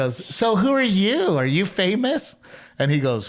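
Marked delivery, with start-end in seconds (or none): 0.2-2.4 high, drawn-out mock woman's voice; curious woman. Woman asking a giant country singer who he is, high and drawn out. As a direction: lift the pitch and stretch both questions.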